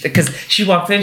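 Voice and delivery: usual speaking voice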